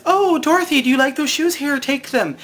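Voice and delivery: high, loud sing-song good witch offering shoes; gushing high fairy voice handing over shoes; Glinda voice. Retold or imitated. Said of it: "Glinda says".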